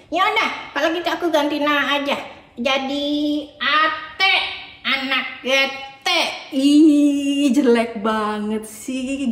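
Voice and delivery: put-on a whiny little boy who keeps complaining; sulky puppet boy. Puppet Adit voice.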